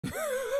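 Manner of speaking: squeaky burst of laughter; giggling squeal; high-pitched laugh. Real, not acted. Laughing at own joke.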